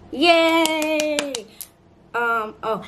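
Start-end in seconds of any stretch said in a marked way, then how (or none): 0.1-1.3 loud, high drawn-out cheer. Real, not acted. Birthday girl applauding her own song, cheering. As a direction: cheer for yourself, high and long.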